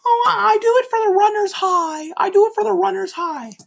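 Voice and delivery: high falsetto mockery of a runner; mocking runner. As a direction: parody a smug runner in falsetto.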